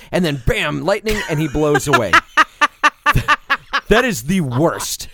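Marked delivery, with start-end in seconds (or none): 2.2-3.8 loud laugh. Real, not acted. Laughing at bad scene.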